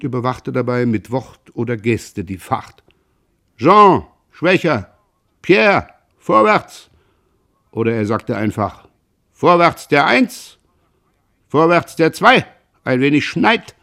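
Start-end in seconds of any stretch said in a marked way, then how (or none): 3.6-6.9 slow, loud, raised rowing commands; called orders. A narrator's reading. Father calling orders to his rowing sons, loud and slow. 9.3-13.7 loud, raised calls with rising ends; rowing commands. A narrator's reading. Father urging his sons at the oars, loud calls.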